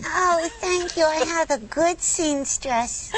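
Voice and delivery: high, slow sweet puppet voice; little girl sock puppet voice; Lamb Chop voice. Pretend.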